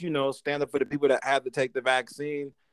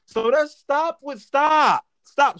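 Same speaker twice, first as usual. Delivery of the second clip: emphatic stop; 10 semitones higher, 4 dB louder, much slower than usual. Real, not acted. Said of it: "telling everyone to stop".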